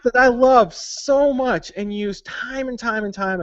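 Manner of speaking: high, drawn-out dramatic complaint; playful betrayed outrage; comic indignation. Real, not acted. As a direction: lament it theatrically, stretching the key words. Dramatic reveal of a beloved culprit.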